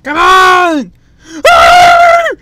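loud impatient yell at the screen; screaming come on at a stuck game; frustrated shout. Real, not acted. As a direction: bellow it out in real frustration. Gamer yelling at loading screen.

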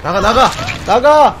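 loud yelling. Real, not acted. Yelling at players to get out.

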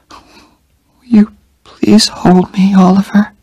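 slow, high whisper; faint whispered plea. A narrator's reading. Dying Jenny asking Oliver to hold her, slow whisper.

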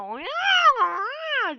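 high, loud mimicked monster yell; imitated creature scream. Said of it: "Pezevenk bir bağırıyor". (yaratığın bağırışını taklit ederek)

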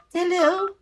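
very high, dainty phone greeting. A narrator's reading. Girl answering pig's telephone call, very high voice.

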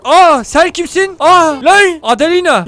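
high, drawn-out delighted surprise; surprised. Real, not acted. Gamer spotting a villager he knows, surprised.

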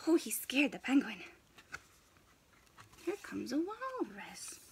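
hushed whisper. Acted. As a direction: whisper the aside. Whispered aside.

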